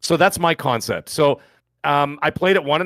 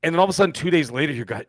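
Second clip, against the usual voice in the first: normal talking voice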